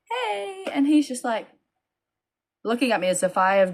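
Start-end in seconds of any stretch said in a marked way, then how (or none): 0.1-0.7 awkward hey. Retold or imitated. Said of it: "I'm just like".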